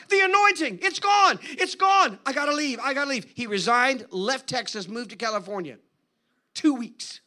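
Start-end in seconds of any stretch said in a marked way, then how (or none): 0.1-3.3 high, loud despair sinking to resignation; distraught, fading. Retold or imitated. Pastor telling Steve he must leave, desperate then resigned. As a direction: start frantic, then drop into giving up.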